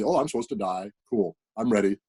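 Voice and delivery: ordinary delivery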